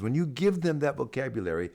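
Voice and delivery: usual speaking voice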